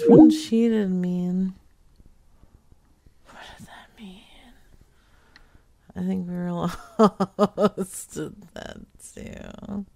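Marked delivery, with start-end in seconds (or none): 3.3-4.2 whispered question; hushed whispered question. Real, not acted. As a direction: whisper it to yourself. Whispering a repeat.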